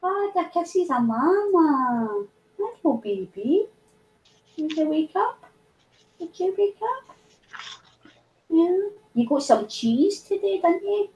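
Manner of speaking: soft, lilting pet voice; slow, high-pitched doting. Real, not acted. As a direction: coo to a beloved pet. Baby-talk to a pet.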